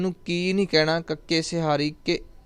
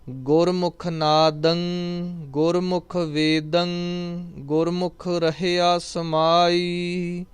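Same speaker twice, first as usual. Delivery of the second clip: slow, drawn-out devotional chanting of each line; scripture recitation; 3 semitones higher, slower than usual. Acted. Teacher reciting Gurbani for students, slow devotional chant. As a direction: intone the verse slowly and reverently.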